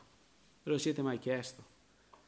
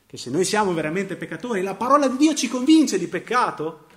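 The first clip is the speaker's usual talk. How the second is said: much louder and higher, building up; climactic preaching; 6 semitones higher, 10 dB louder than usual. Real, not acted. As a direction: swell to the peak of the sermon.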